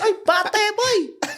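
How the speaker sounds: put-on high, drawn-out Filipino-accented call; Tito Per's Filipino accent. Nephew imitating his uncle's yell, Filipino accent. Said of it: "In his funny little Filipino accent".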